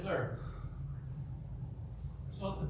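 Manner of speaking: ordinary talk, nothing marked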